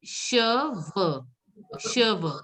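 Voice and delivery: cheveux as "shuh-vuh", first split, then joined; careful falling cheveux starting with "sh". Shown as a demonstration. Teacher sums up C H, cheveux as "shuh-vuh". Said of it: "C H is pronounced as che".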